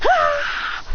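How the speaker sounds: very high attack yell; battle cry. Acted. Boy launching his attack at a rival, very high yell. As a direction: let out a piercingly high "aah".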